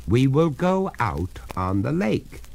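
resolute, measured insistence; firm. Acted. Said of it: "said the master firmly".

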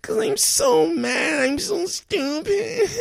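slow, very high fake-crying wail; hammed-up sobbing. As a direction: drag it out in a mock sob.

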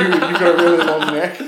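loud, laughing through the words; loud amused outburst. Real, not acted. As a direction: crack up while teasing a friend. Cracking up at friend's long neck.

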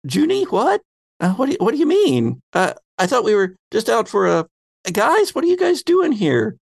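high, bewildered voice; confused Shirley. Acted. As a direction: pitch way up in confusion. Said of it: "she looks at him and goes".

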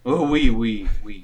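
put-on a thin French accent saying oui; mock French accent. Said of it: "one of you have a Jamaican French accent".

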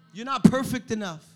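normal talking voice

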